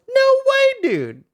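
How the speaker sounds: squeaked way up high in disbelief; shocked no way, pitched very high; stunned disbelief. Real, not acted. Streamer reading nerf to his skill, high shocked squeak. Reacting to patch note nerf.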